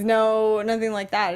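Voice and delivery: normal talking voice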